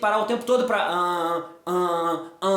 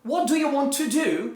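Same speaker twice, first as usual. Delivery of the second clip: yet another English accent; 2 semitones higher, slower than usual. Shown as a demonstration. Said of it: "Tá tudo com sotaque diferente".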